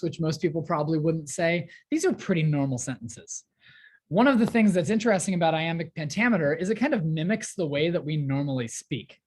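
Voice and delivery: ordinary delivery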